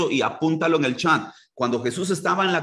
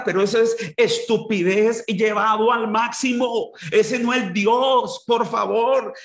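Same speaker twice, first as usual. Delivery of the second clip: fiery preaching; 5 semitones higher than usual. Acted. Pastor rebuking believers who call disasters God's judgment, fiery preaching. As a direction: rebuke the congregation with fire.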